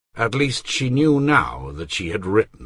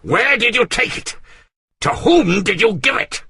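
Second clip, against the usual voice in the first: higher, louder furious demand; shouting; 14 semitones higher, 6 dB louder than usual. Acted. Artois shouts at Bersamet, furious. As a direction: bellow it at the servant. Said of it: "shouting at the top of his voice".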